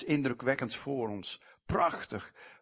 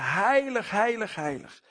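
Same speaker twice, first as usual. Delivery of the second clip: higher and louder, called out like angels; angels calling out; 7 semitones higher, 4 dB louder than usual. A narrator's reading. Preacher voices angels calling across the temple, raised call. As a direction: cry the word heilig out high and loud. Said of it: "de engelen riepen".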